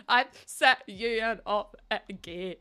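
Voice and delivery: slowed, broken words of a hammed-up sob; mock sobbing. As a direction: wail it in pretend tears, half laughing. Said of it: "I'm sobbing going".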